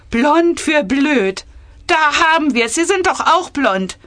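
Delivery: high, loud acted bickering; mocking squabble. A narrator's reading. Ministers squabbling over hair colour, high mocking bickering. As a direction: jeer and retort with hammed-up indignation.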